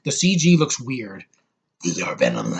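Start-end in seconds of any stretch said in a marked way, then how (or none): 1.8-2.5 Venom. Acted. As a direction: drop far down into a menacing creature growl. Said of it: "And Venom".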